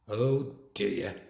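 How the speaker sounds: low, soft murmur; Matron's quiet mock-sympathetic murmur. A narrator's reading. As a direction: mutter it under your breath, low. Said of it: "murmured Matron".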